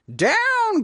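high, loud commanding call; urgent cry. A narrator's reading. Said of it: "cried the witch".

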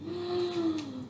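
sharp startled gasp; a quick shocked intake of breath. A narrator's reading. Tries landing, fails.

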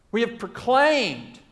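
normal talking voice

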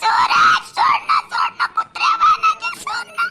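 high-pitched wailing pleas to let go; shrieked cries for help, drawn out; distressed screaming. Acted. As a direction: scream and struggle to break free. Woman seized, struggling, begs for release.

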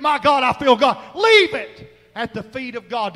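high, loud preaching cry calling out names; shouted altar call. Real, not acted. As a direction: shout each name, begging them to let go.

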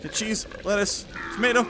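ordinary talk, nothing marked

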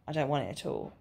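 usual speaking voice

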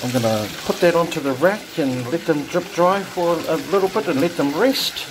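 ordinary talk

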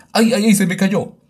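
high, loud alarmed yelp; real panic as it slips; alarmed cry. Real, not acted. Drops the baby in game.

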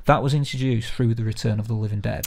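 ordinary delivery

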